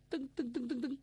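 spoken footstep sounds, brisk; imitated footsteps. A narrator's reading. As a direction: tap out hurrying steps with the voice. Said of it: "脚步声响".

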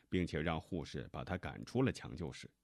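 plain reading voice

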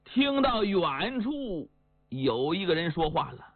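ordinary delivery

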